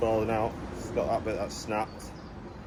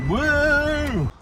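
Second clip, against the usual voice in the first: high, loud whoop of delight; excited whoop; 7 dB louder than usual. Real, not acted. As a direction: let out a happy whoop. Celebrating arriving at same time.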